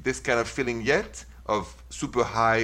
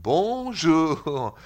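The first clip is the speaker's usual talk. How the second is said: long, higher, stretched Swiss French "Bonjour"; Swiss French accent; 5 semitones higher than usual. Shown as a demonstration. Host contrasts with the French take, slow Swiss French accent. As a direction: drag out each syllable in mock Swiss French. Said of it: "The Swiss goes".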